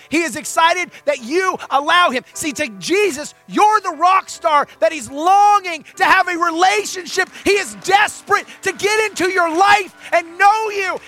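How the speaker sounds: preaching at full force. Acted. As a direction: pour out the longing of Jesus from the pulpit. Sermon climax about Jesus.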